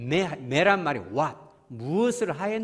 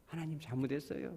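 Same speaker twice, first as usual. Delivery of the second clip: hushed confession; 9 dB quieter than usual. Retold or imitated. Modeling confession to God.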